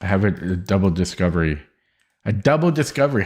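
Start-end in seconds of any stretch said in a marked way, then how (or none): 2.3-3.2 excited. Real, not acted. Excited repeat of chess find.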